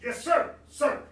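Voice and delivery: loud, high military response; eager-to-obey soldier's shout; recruit's reply. Acted. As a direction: answer the sergeant at full volume. Recruit answering drill sergeant.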